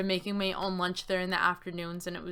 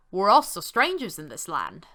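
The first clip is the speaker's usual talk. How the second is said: put-on slightly louder, accidental Australian accent; 6 dB louder than usual. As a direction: slip into a light Australian accent. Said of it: "that was an Australian accent".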